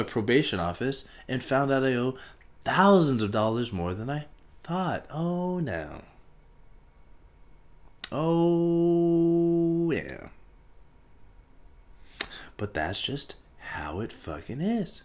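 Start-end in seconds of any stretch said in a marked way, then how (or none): none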